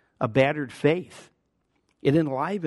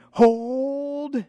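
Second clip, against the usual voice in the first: much higher, ringing shout of hold; a battle leader steadying his line; mock battlefield command; commander's cry; 12 semitones higher, 3 dB louder than usual. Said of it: "the leader out there going".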